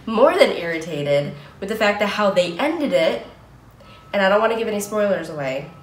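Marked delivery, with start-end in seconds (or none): 0.1-3.3 louder, emphatic mild annoyance; mildly irritated. Real, not acted. Venting to viewers about a show's ending, irritated. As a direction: vent a little irritation, punching the words. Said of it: "I'm a little irritated".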